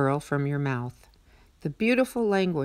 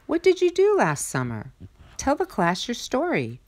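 higher, bright teacher voice; teacher asking; 8 semitones higher than usual. A narrator's reading. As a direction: ask like a friendly teacher. Teacher asks the class.

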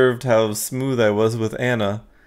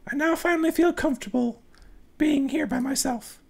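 much higher polite woman's voice; woman client. Acted. Anna thanks the ghost hunter, much higher woman's voice. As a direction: play the relieved client, high and gracious.

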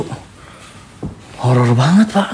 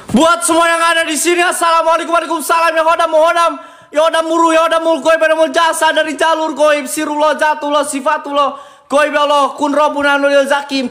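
higher chanting summons to spirits; mock incantation; 9 semitones higher than usual. Host calls spirits in the room, mock shaman chant. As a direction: call out to the khodam like a shaman.

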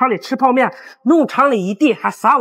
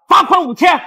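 shout; 5 semitones higher, 4 dB louder than usual. Acted. Supervisor fines fake boss.

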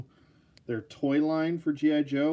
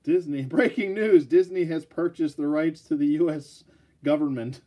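news anchor; 3 semitones higher, 6 dB louder than usual. Pretend. Mock news bulletin.

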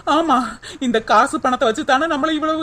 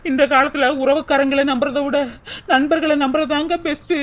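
usual speaking voice

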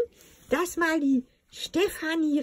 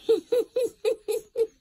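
laughing so hard she can't go on; breathless fit of giggles; helpless laughter; 7 semitones higher than usual. Real, not acted. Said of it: "Ich kann nicht mehr".

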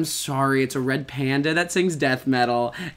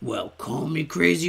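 ordinary talk, nothing marked

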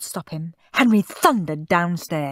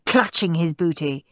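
usual speaking voice

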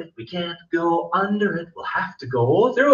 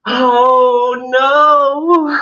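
loud, higher oh no; mock alarm; 6 semitones higher, 8 dB louder than usual. Teacher faking alarm for first graders, loud and higher. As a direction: cry out in playful alarm.

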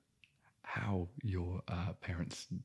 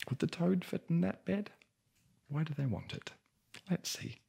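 higher, curious wondering aloud; puzzled aside; 11 semitones higher than usual. Real, not acted. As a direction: ask it with amused disbelief. Amused aside to listeners.

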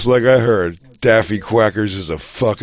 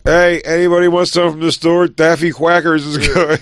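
raised, higher voice to the room; mock holler offering a store run; calling out; 6 semitones higher than usual. Acting out the old comic asking everyone, calling out.

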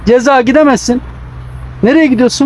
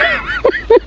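shrill burst of laughter; high squealing laugh; 3 dB quieter than usual. Real, not acted. Laughs during freeze tag.

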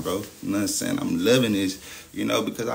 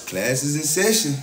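announcing; 5 semitones higher, 5 dB louder than usual. Acted. Announcing class opening.

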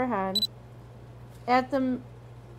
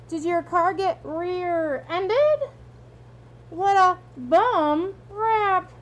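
high, slow put-on old lady; fake high old lady voice; 7 semitones higher, slower than usual. Dad teasing about Mister Tushman's name, fake old lady voice. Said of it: "using a fake high old lady voice".

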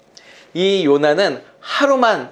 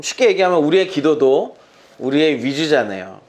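ordinary talk, nothing marked

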